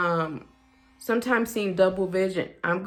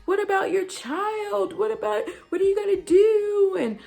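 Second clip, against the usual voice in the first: very high panicky imitation, building louder; mock freak-out; 14 semitones higher, 4 dB louder than usual. Supervisor reacting to an MS diagnosis, mock freaking out. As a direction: shriek the warnings in hammed-up panic. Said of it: "freaking out".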